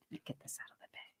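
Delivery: quick breathy whisper under the breath; hushed fast murmur to herself; whispered aside. Real, not acted. Whispering to herself while pulling the crystals from the bag.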